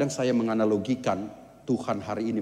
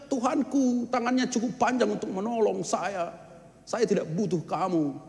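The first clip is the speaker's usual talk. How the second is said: higher, self-assured pious man, softer; proud refusal; 7 semitones higher, 5 dB quieter than usual. Acted. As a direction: brush off the helper smugly. (orang saleh menolak dengan sombong)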